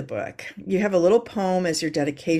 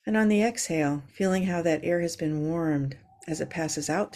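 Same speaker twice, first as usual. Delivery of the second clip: calm grounding exercise. Acted. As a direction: ease them into their breath. Leads a yoga breathing moment.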